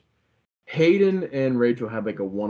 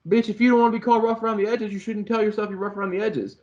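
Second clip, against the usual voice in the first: mocking quote; 9 semitones higher, much faster than usual. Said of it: "he was like".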